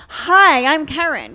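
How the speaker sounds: loud, bright self-introduction; forced loud intro. Retold or imitated. Introducing herself to a comic's girlfriend, loud cheerful greeting. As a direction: push in with an overly cheerful hello. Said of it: "I have to be like".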